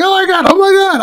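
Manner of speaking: imitating daughter shrieking at a nude scene; daughter's shocked squeal. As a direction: squeal it like a horrified teenager.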